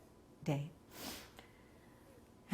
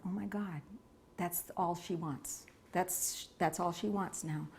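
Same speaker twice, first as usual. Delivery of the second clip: soft, slowed inner thought; a quiet dawning of understanding; hushed realization; 7 dB quieter than usual. Retold or imitated. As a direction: say it under your breath, moved. Realizing what the dying woman wants.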